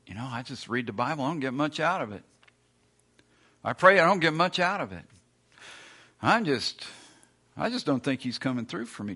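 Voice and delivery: mock sulky griping; whiny complaint. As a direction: grumble like a disappointed skeptic. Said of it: "You go".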